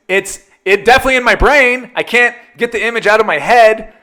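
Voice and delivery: high, loud anguished complaint, hammed-up; mock-traumatized protest to dad; retold exasperation. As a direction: wail it in comic exasperation. Retold complaint to dad about image.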